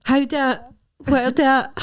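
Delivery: high mock-baffled imitation; mimicking co-host. Teasing a co-host about yesterday, high mocking imitation. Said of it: "You yesterday were pure".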